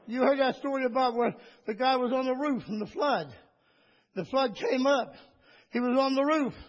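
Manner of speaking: usual speaking voice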